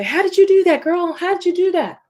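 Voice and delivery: high, amazed friend asking how; hammed-up amazed girlfriend voice; impressed friend. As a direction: gush like a friend who is wowed. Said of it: "Like when they say".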